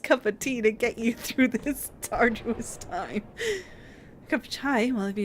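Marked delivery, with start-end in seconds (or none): 0.0-3.2 much louder, slow, deadpan blunt jab; hammed-up tactless sympathy; blunt mockery. Player mocks villager's misery, loud slow blunt jab.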